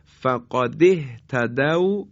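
فقد اهتدوا said slowly, the hamza joined; slow recitation. Shown as a demonstration. Teacher reads the connected phrase slowly to the class.

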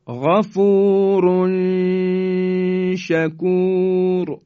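full-voiced Quranic recitation with a nasal hum; ikhfa recitation. Shown as a demonstration. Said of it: "مثال بعدی". (تلاوت بلند و آهسته غفورن شکور با اخفا)

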